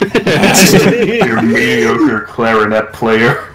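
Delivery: very slow, laughing drawl; laughing repeat. Retold or imitated. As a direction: stretch it out while laughing.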